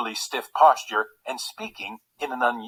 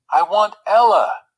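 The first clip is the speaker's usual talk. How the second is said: louder, higher burst of want; insistent outburst; 8 semitones higher, 7 dB louder than usual. Acted. Frederick insists to Reginald he wants Ella, loudly. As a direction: plead it hard.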